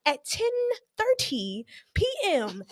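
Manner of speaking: ordinary delivery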